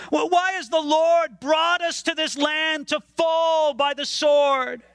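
angry accusation. Acted. Israelites shouting their complaint at God, angry and high.

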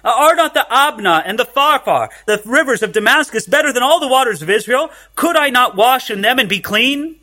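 a touch of offended pride in the reading; indignant Naaman. Retold or imitated. Preacher voices proud Naaman scorning the Jordan, mildly indignant. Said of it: "Naaman became furious".

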